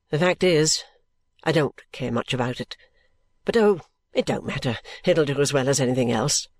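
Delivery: low, offhand character voice; Richard, unconcerned. Acted. Richard answering his friends about the profession, unconcerned. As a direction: drop low and shrug the matter off.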